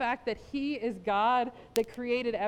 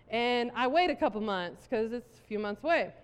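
ordinary talk

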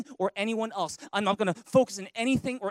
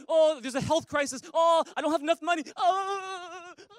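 a hint of fretful whining, pitched up; mock worry; 8 semitones higher than usual. Preacher imitating a worrier over health and money, mock fretting. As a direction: fret aloud like a worrier.

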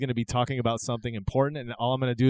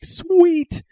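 much higher, louder delighted cheer; excited; 9 dB louder than usual. Retold or imitated. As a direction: squeal it like a thrilled fan. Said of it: "we would have all been like".

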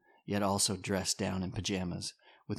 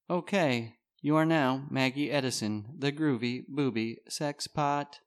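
robot voice; 5 semitones higher than usual. Acted. Smartphone voiceover reply.

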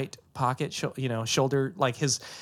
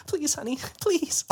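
high, begging please; high pleading; 12 semitones higher than usual. Retold or imitated. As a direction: plead in fear, pitched up. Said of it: "She's like".

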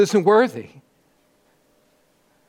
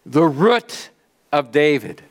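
ordinary talk

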